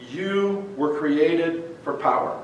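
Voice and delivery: slow, soft, deliberate statement; quiet emphasis. Real, not acted. Pastor quietly reminding the church of their purpose, slow and soft. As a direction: drop the voice to drive it home. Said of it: "slow quiet restatement".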